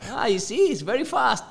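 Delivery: very high, gleeful imitation breaking into laughter; laughing boast. Friend showing off his speed to kids, laughing boast. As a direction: crow about it in a high voice, laughing.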